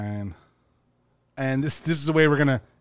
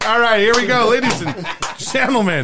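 raised, laughing host intro; game show host voice; 5 semitones higher than usual. Acted. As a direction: welcome the crowd like a TV host.

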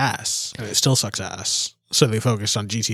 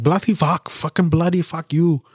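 shouted mock British swearing, higher; imitating Indian guys cursing in English, mock British; 5 semitones higher, 3 dB louder than usual. To friend, retelling a road rage video: shouted mock British cursing. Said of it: "And they're like".

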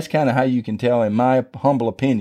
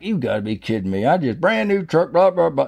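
mild mock outrage, climbing in pitch; mad inner rant; 5 semitones higher than usual. Preacher retelling his thoughts over the dented truck, mock mad. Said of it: "I'm just inside my head mad".

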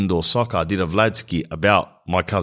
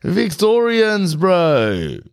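hammed-up ad voice; 13 semitones higher, much slower than usual. Put-on ad voice for sponsor.

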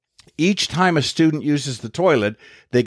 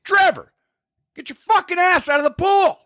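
furious high yell across the pool; angry yell. Retold or imitated. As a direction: blast it out the window at the kid. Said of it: "I yell".

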